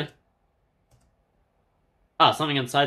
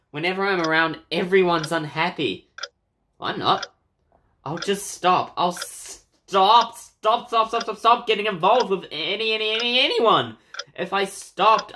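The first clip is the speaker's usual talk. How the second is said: put-on higher, anguished girl's voice repeating stop; distressed ghost girl; 6 semitones higher, 3 dB louder than usual. Ghost girl blaming herself, anguished and rising. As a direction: plead like a girl falling apart.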